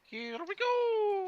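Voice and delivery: very high, slow squeaky Mario. Retold or imitated. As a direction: sing it out like Mario. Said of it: "What's that?".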